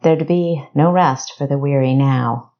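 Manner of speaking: ordinary delivery, nothing marked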